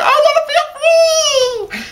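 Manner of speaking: high, drawn-out, hammed-up scream into the rain; mock scream. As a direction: belt it out like a yell at the sky. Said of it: "just screams into the rain".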